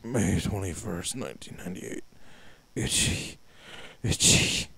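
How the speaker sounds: zombie mumble. Acted. Voicing the keeper's final entry, hollow and breathy. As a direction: let the words rot into a breathy groan. Diary writer turning into zombie.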